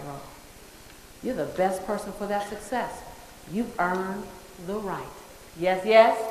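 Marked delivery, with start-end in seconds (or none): 5.6-6.2 loud rising call for a yes; a strong, loud call-and-response yes. Real, not acted. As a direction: call out to the crowd, asking yes. Rallying the audience to answer.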